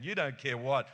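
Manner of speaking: ordinary delivery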